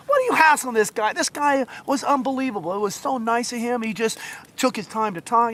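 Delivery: high-pitched retold telling-off; barking scolding. Woman defends the gift giver, barking on and on. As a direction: scold them in indignant defence of him. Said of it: "just barking".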